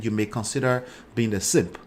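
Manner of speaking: ordinary delivery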